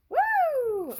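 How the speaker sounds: high, loud joyful woo; excited whoop. Real, not acted. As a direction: let out a release whoop. Whoop of release in reading.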